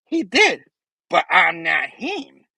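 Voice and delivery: high, slow, ominous villain; Green Goblin voice. Retold or imitated. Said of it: "Goblin's like".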